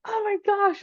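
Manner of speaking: high-pitched acted exasperation; overwhelmed mock outcry; exasperated. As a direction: exclaim it at wits' end, voice leaping up. Acting out her exasperation on call.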